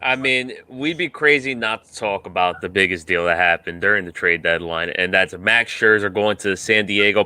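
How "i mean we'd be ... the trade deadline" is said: ordinary delivery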